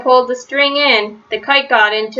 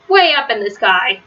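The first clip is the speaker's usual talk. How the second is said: plain reading voice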